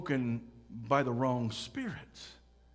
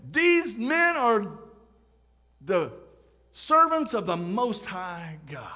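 loud, high, drawn-out proclamation; proclaiming demon-possessed girl; 4 semitones higher, 6 dB louder, slower than usual. Retold or imitated. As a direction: call it out slowly over the crowd. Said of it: "who's saying".

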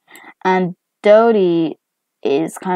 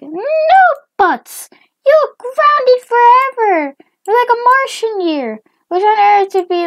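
high, loud mom decree; hammed-up outraged parent; angry scolding; 7 dB louder than usual. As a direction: lay down the punishment in a shrill yell. Mom grounds daughter in anger.

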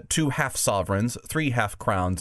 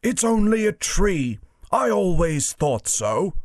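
higher-pitched warthog character; a raised, drawn-out warthog voice; 8 semitones higher, 3 dB louder than usual. Acted. Warthog to the other animals about the tree, higher warthog voice. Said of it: "said a warthog".

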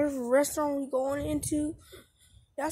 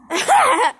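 loud high laugh; burst of laughter; 10 semitones higher, 10 dB louder than usual. Real, not acted. Kid laughing loudly at his own fake address. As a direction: laugh out at your own joke.

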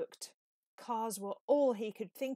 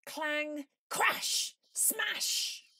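higher, punchy noise words; fight sound effects; 7 semitones higher, 4 dB louder, much slower than usual. A narrator's reading. The fight in the kitchen, noise words called out high and punchy. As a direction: bang out each crash like a comic.

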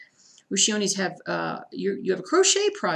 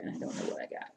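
under breath; 11 dB quieter, faster than usual. Real, not acted. Muttering over lost stitching.

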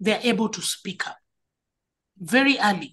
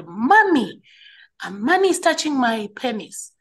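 put-on mildly raised, higher-pitched child's cry for mother; retold scream; 4 semitones higher, 5 dB louder than usual. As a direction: imitate a child calling out in alarm, half-strength. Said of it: "He screamed and he said".

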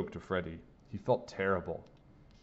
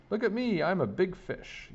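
playful yell; 11 semitones higher than usual. A narrator's reading. Freddy showing off to friends in the pool, playful high yell. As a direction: show off in a high voice, stretching the words. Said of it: "yelled Freddy".

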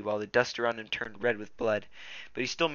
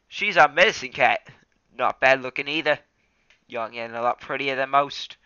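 Graypaw as a loud, higher drawling Aussie-Texan; Australian or Texan twang; 3 semitones higher, 7 dB louder than usual. Acted. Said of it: "I don't know if he's Australian or Texan".

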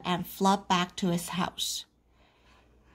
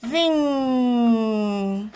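long, ringing zing; zinging noise; 2 semitones higher than usual. A narrator's reading. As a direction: stretch the zing like a springing coil. Sound effect of unwinding spring.

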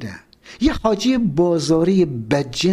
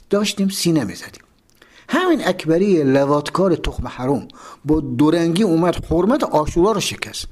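ordinary delivery

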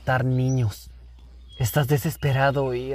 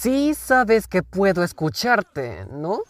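slow, higher-pitched reminder; pointed incredulity; 6 semitones higher, slower than usual. Acted. Visitor to plotters who forgot he hears, pointed incredulity. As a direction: point out the obvious, drawn out. Overhears plot against himself.

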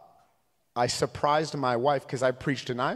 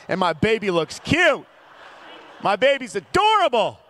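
higher, louder mock-proud boast; proud dad insisting; 8 semitones higher, 5 dB louder than usual. Dad defending how he dressed his baby, proud insisting. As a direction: build to a high, emphatic boast.

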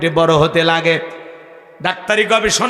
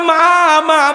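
calling out; 9 semitones higher, much slower than usual. Acted. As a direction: call through the closed door. Son calls at mother's door.

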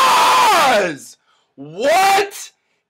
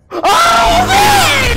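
panicking gamer; 14 semitones higher, 4 dB louder than usual. Real, not acted. Monster reaches his hiding house.

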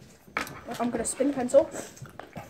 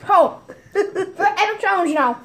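laughing; 6 semitones higher, slower than usual. Real, not acted. Laughing at friend.

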